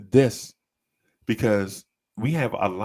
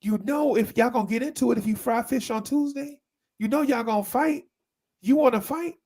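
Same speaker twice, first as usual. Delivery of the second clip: nagging elder; 13 semitones higher than usual. Retold or imitated. Mocking elders' bad advice.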